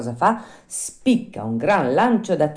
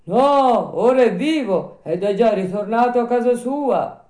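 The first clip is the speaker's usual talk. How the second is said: put-on higher, louder old man's voice; voiced old man, raised pitch; little old man; 10 semitones higher, 7 dB louder than usual. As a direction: tell the news as a village old man. Old man corrects the puppet.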